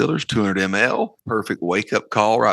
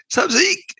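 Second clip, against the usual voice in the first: much higher, breezy mock "Sup, Zeke?"; mock stranger greeting. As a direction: imitate a stranger calling out casually. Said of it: "he's like".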